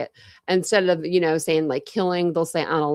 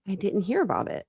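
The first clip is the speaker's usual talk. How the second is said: ordinary delivery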